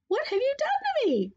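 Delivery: very high princess squeal. A narrator's reading. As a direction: squeal in outraged shock. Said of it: "squealed the princess".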